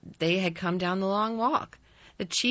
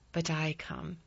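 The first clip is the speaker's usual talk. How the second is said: grumpy obstinacy; 5 dB quieter than usual. A narrator's reading. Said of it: "with grumpy obstinacy".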